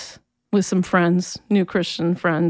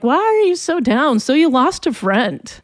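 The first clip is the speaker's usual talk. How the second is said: higher-pitched, imitated family needling; dismissive relatives; 6 semitones higher than usual. Family to the grieving teenager at home, dismissive. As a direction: act out an unsympathetic relative. Family mocking her low mood.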